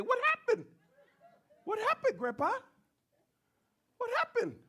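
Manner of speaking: put-on high, eager child's voice; child asking grandpa. Shown as a demonstration. Preacher shows kids how Luca asks Grandpa, high child voice. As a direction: ask it like a curious grandchild. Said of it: "you gotta say it like".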